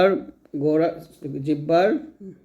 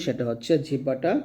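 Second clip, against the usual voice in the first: ordinary talk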